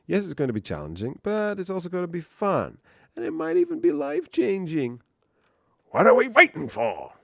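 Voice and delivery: high, swooping mock sales pitch; hype promo read. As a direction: build to an over-the-top rallying cry. Reads course blurb aloud.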